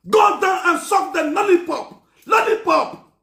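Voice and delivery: slow taunting yell; mock shout. Crude mocking chant.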